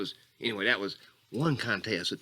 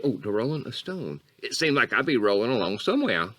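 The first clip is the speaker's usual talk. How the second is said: normal talking voice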